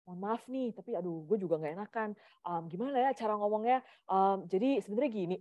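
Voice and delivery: hesitant, roundabout apology. Retold or imitated. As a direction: imitate someone too shy to get to the point. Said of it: "Kalau misalnya di atas bumbu-bumbunya banyak".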